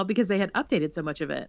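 ordinary delivery

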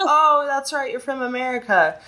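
high-pitched imitation of coworkers catching on; mock realisation, starting high; dawning realisation. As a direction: act out a sudden "ah, of course". Acts coworkers' sudden realisation.

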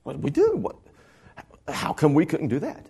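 sudden high squeak of confusion on "What did we do?"; mock bewildered disciples; baffled disciples. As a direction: caught off guard, scrambling for an answer. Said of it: "now they're just like".

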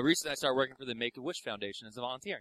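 low, soft, rushed mumble; McGrail's way of talking. Retold or imitated. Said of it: "that's how he talks".

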